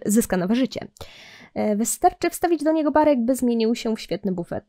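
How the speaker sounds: ordinary talk, nothing marked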